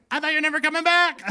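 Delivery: very high, loud dog voice; hammed-up high pet squeal; talking dog voice. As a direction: play an overexcited dog greeting its owner. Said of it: "talk to my dog and for my dog".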